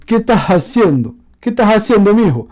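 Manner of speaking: a loud Ecuadorian calling to someone; Ecuadorian accent. Shown as a demonstration. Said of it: "Et il dit".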